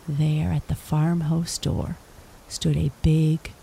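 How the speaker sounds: ordinary delivery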